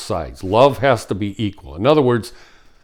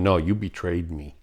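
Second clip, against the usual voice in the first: softer, firm "No" falling; quiet firm refusal; 4 semitones lower, 6 dB quieter than usual. Retold or imitated. Betrayed partner quietly refusing the cheater, firm. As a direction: say "No" quietly and firmly. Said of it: "this person going".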